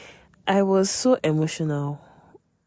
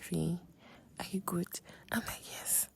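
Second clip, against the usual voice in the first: very soft, near-whispered quotes; retold hushed exchange; 14 dB quieter than usual. Friend checks on her in the choir, hushed. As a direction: drop to a discreet murmur.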